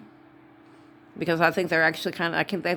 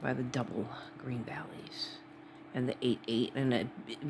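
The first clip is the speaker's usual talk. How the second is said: hushed whisper; 4 semitones lower, 11 dB quieter than usual. Real, not acted. Weirded out by the coincidence, whispering. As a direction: drop to a breathy whisper.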